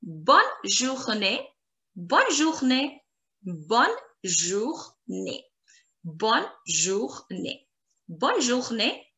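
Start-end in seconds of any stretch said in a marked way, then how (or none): 3.4-5.5 slow, low Bonne, jour, née with gaps; syllable by syllable. Shown as a demonstration. Teacher drills have a nice day, Bonne journée slowly split. 6.0-7.7 slow, softer Bonne, jour, née; syllable by syllable. Shown as a demonstration. Teacher drills learners, Bonne journée slow and split.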